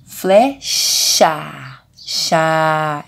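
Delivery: FLECHA split up, FLÉ... CHAAA, CHAAA; drawn-out vowels. Shown as a demonstration. Teacher sounding out the word, long vowels in FLECHA. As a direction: stretch the A of CHA each time. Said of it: "Eu falo a vogal".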